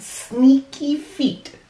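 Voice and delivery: ordinary delivery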